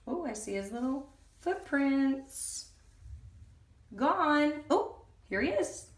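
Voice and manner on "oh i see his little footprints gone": usual speaking voice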